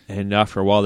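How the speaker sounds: ordinary talk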